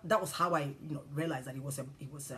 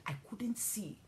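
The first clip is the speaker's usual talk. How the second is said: hushed whisper; 4 semitones higher, 5 dB quieter than usual. Retold or imitated. Telling of blinding rain.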